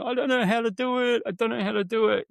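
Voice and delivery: high, fast, whiny mock helplessness; mock helpless battler. Coach mimics struggling clients, high and fast mock whine. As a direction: imitate a flustered person stuck and pleading. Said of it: "those people who are going".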